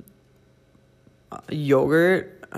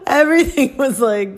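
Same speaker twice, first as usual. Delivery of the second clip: laughing at her own meltdown, close to tears; laughing while crying; 8 semitones higher than usual. Real, not acted.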